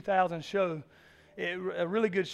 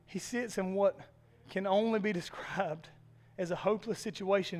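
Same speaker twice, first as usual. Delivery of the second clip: ordinary talk, nothing marked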